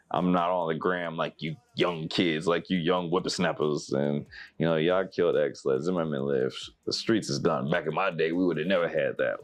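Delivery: normal talking voice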